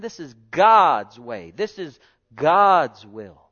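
loud, slow pulpit declaration; slow emphatic preaching. Acted. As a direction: lay it down word by word.

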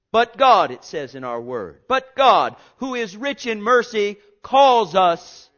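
loud, high, slow preaching build; preaching at full force. Acted. Preacher proclaiming God's mercy to the church, loud and slow. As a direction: proclaim it to the congregation.

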